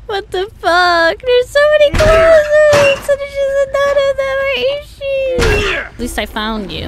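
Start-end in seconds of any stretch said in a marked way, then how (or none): 0.1-5.7 very high, drawn-out whine; exasperated wail. Real, not acted. Player fed up searching through clothes, high wailing complaint. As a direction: wail it high, stretching the words. Player losing it over game.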